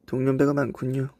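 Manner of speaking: ordinary talk, nothing marked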